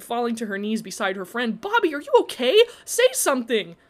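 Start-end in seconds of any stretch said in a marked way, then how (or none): 1.6-3.8 high, loud panicked pleading; frantic plea. A narrator's reading. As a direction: beg him to answer, scared. Friend collapsed, urgent plea.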